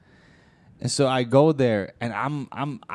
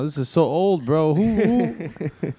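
mock doctor; 4 semitones higher, much slower than usual. Doctor voice in the joke.

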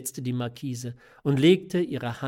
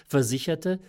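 plain reading voice

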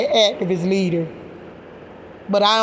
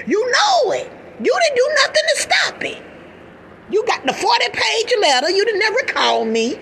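much higher, indignant accusation; outraged accusation; 11 semitones higher than usual. Real, not acted. Accusing the grand jury who ignored her letter, outraged and high. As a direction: pitch flies up in outrage.

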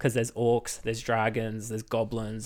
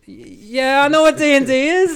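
mock indignant; 12 semitones higher, 8 dB louder than usual. Answering the auntie's question.

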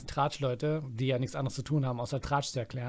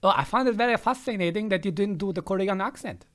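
put-on higher English with East German accent; Ossi-accented English; 5 semitones higher than usual. Answering the fake interview, Ossi accent in English. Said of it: "Kannst du Englisch so reden mit dem Akzent".

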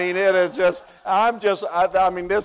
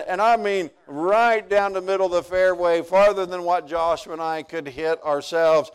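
normal talking voice

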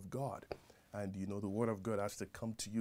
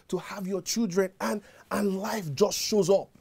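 loud, higher, driving sermon voice; full-force preaching; 8 semitones higher, 10 dB louder than usual. Acted. Preacher drives the message home to the congregation, loud and fervent. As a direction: preach it out with rising fervour.

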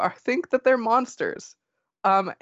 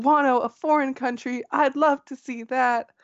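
put-on high, wide-eyed baby-animal voice; little babies; 6 semitones higher than usual. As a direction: play an adorable little kid, pitched up. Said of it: "as little babies going".

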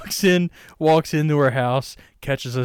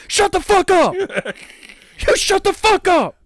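much higher, louder screaming; mock yell; 5 dB louder than usual. Yelling at Greta in the joke story, screaming loud.

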